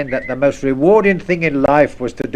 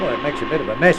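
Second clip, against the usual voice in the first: ordinary talk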